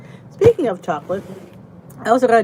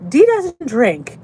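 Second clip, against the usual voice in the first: mock firm; 5 semitones higher, 8 dB louder, much slower than usual. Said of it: "when I go".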